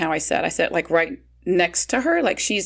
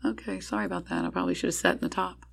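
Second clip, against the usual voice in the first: quiet apology; 10 dB quieter than usual. Retold or imitated. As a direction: mumble an embarrassed sorry.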